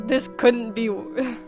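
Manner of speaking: usual speaking voice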